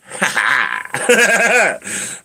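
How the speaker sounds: high-pitched burst of laughter; hearty laugh. Real, not acted. Laughing at his own shower plan, hearty laugh.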